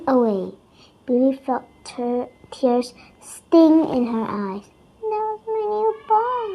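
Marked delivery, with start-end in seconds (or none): 5.0-6.5 small, higher and softer voice; little voice. A narrator's reading. Billy to herself after losing her ball, in a small voice. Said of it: "she said in a little voice".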